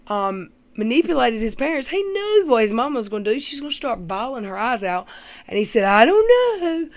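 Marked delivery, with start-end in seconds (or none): 5.8-6.9 high, slow whiny shrug. Retold or imitated. Mocking the boyfriend's high, slow shrugging whine. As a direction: stretch it out like a pouting kid. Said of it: "like the little, um, emoji".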